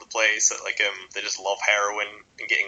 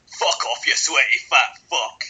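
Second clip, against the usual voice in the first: angry Scot; 5 semitones higher, 5 dB louder, slower than usual. Retold or imitated. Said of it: "And he goes".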